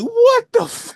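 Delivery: high, loud mock outcry of disbelief; shocked audience. Recalling the cinema audience at the boat ending, shocked outcry. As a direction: cry out like a stunned crowd. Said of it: "the entire audience was like".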